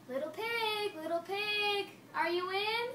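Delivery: high sing-song call to the pig; wolf calling sweetly through the door. Acted. Wolf calls at the pig's door, high sing-song voice. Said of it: "so I said".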